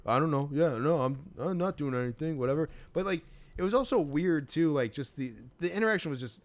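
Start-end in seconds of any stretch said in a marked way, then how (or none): 0.1-2.7 higher, put-on slacker bro voice; trying to talk cool. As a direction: imitate a kid playing it cool. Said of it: "just talk cool".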